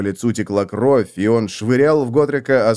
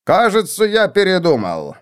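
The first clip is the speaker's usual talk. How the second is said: nasty sneer; 7 semitones higher than usual. Acted. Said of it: "гнусно ухмыляясь".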